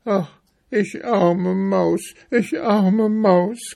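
high, slow mouse wail; self-pitying little mouse moan; lamenting. A narrator's reading. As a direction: lament pitifully in a drawn-out, high voice. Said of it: "klagte Mariechen Knusperkorn".

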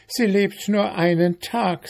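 high, scornful ant voice; gossiping ant. A narrator's reading. Ant telling beetle about mayfly, scornful.

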